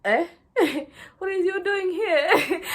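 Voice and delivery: high, loud, drawn-out imitation through laughter; mock indignant disbelief. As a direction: laughingly mimic someone appalled at the newcomers. Mimics club crowd's reaction to them.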